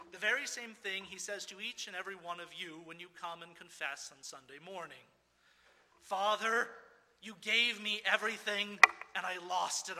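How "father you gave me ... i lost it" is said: loud, earnest plea of a sinner; pleading confession. Retold or imitated. Sinner confessing to God the Father, loud and pleading. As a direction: cry it out as if begging a father.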